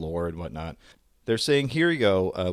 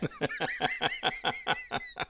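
breathy laugh; 8 semitones higher, 7 dB quieter than usual. Real, not acted. Laughing at the joke.

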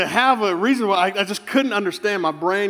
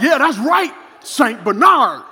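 loud, high mock-victorious shout; triumphant taunt; 6 semitones higher, 6 dB louder, slower than usual. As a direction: crow over the retreating dog. Triumphant taunt at the retreating dog.

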